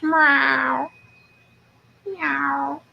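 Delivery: high mock meow of the cat. Owner voicing her cat, high playful meow. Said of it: "He says".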